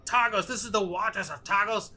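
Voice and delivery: high, loud protest in character; indignant prisoner. Acted. Prisoner telling captors they are unlawful, high-pitched indignation. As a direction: protest like an official wronged by ruffians.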